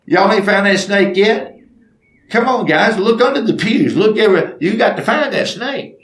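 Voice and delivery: rally the searchers from across the room; acted urging.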